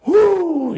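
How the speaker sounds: high loud ric flair woo; Flair whoo. Retold or imitated. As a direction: belt out a wrestler's woo.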